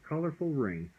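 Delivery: plain reading voice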